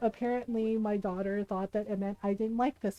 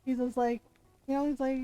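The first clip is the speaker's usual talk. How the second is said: ordinary delivery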